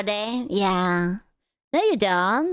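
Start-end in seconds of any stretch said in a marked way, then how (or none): none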